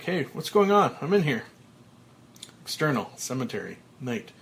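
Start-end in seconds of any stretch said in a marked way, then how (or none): 0.0-1.4 louder, higher shout for attention; calling out. Acted. Frank calling to the gravediggers, loud alarmed shout. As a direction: yell through the coffin lid.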